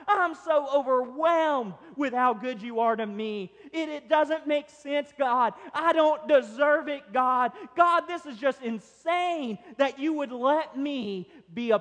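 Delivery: high, loud, overwhelmed praise; crying out gratefully. Acted. Believer overwhelmed by God's blessings, loud fervent cry. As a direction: cry out in amazed thanksgiving. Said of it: "when have you cried out to God".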